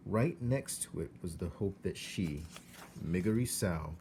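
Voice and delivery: usual speaking voice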